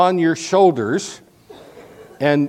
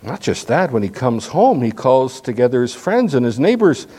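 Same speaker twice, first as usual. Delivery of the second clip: ordinary talk, nothing marked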